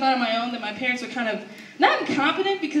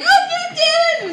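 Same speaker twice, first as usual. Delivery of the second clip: very high, drawn-out tantrum cry; loud hammed-up frustration; mock exasperated wail; 6 dB louder, slower than usual. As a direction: wail like a kid let down yet again. Kid finds dad asleep during movie.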